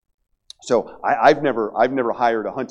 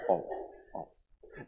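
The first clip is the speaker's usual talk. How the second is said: whispered emphasis; 8 semitones lower, 14 dB quieter, slower than usual. Real, not acted.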